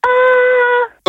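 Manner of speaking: very high sung ah. Pretend. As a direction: hit a high squeaky ah. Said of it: "un «A» aigu".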